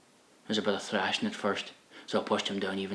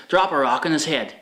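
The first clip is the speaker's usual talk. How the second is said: put-on loud, higher boy's bravado; put-on tough talk, raised voice; mock bravado; 6 semitones higher, 9 dB louder than usual. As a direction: call it out with false toughness. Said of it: "It was all bravado".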